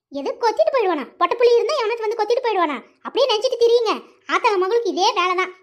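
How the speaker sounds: indignant retort, pitch jumping up; angry. Acted. Mother stung by gossip about her daughter, angry retort. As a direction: throw the words back, offended and cross. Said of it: "ஏன் லட்சுமி அக்கா இப்படி கோவப்படுறீங்க".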